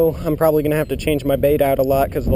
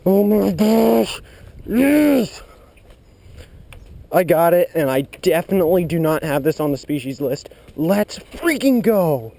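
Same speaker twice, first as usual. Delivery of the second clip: thrilled shouting; 4 semitones higher than usual. Real, not acted. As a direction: celebrate the catch at full volume. Catches new species.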